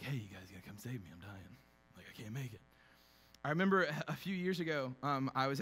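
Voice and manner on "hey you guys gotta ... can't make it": much softer, faint and fading mock call for help; mock dying plea. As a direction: fake a weak dying phone call. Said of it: "be like".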